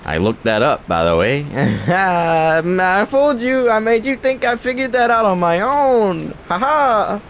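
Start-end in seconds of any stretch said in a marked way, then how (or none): 1.5-7.2 high, slow, hammed-up gloating; mock acting voice. Gamer mocking his audience in a hammed-up high voice. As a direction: ham up fake triumph. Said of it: "see the acting?".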